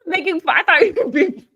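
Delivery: high, loud, tumbling protest; incredulous. Real, not acted. Host fearing co-host mocked her voice, incredulous.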